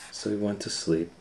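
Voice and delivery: ordinary delivery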